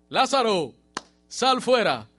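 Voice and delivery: high, loud ringing command; commanding shout. Acted. Jesus calling dead Lazarus out of the tomb, commanding shout. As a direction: call him out of the grave with full force.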